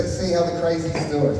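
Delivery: ordinary delivery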